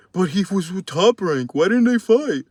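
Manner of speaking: high, whiny voice of a fan making excuses; sarcastic high fan impression; mocking doubter voice. Mocking Spence fans' excuses.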